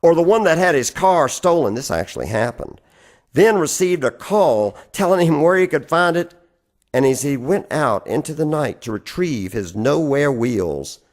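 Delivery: ordinary delivery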